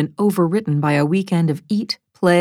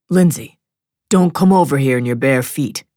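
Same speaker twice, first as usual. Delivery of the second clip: warning husband; 3 semitones lower than usual. Acted. Husband warns wife off broken glass.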